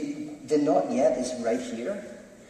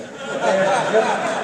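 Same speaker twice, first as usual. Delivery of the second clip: loud laugh; 7 semitones higher, 7 dB louder than usual. Real, not acted. Speaker laughing at his own Homer Simpson joke, loud laughter.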